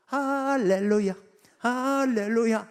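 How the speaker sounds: loud slow drawn-out praise shout; ecstatic hallelujah. Retold or imitated. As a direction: cry it out like dancing worshippers. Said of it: "하면서 막 춤을 추는데".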